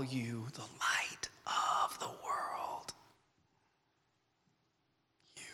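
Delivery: slow, hushed, tender whisper. Acted. Preacher voicing Jesus, whispering a calling to youth. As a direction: breathe the promise softly.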